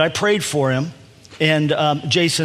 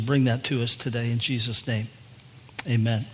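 opening prayer; 2 semitones lower than usual. Acted. Opening prayer before the sermon.

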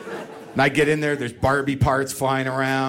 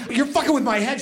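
high, frazzled complaint; lightly voiced frazzled boyfriend; mock exasperated boyfriend; 10 semitones higher than usual. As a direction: throw it back at her, rattled. Acted quarrel, his reply.

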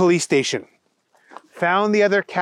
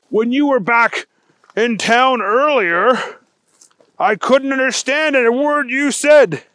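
puzzled special agent; 8 semitones higher, 4 dB louder than usual. Acted. Wendell challenging Thunder Kitty, puzzled. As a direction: protest in raised bewilderment.

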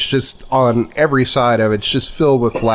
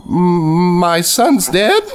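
put-on shocked old mother; 10 semitones higher than usual. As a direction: ask it like the news just hit you. GM voices grieving mother.

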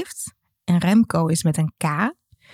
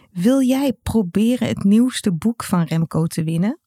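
normal talking voice

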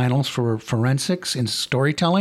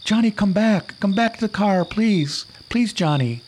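high, raised calling into the dark; 9 semitones higher than usual. Acted. Girl alone in the car calling for Johnny, high and raised. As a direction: call out for him, afraid. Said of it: "So she calls out to him".

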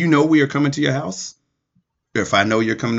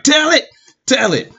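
egging-on listener; 11 semitones higher than usual. Retold or imitated. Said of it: "somebody who's listening to me right now".

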